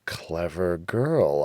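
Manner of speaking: ordinary delivery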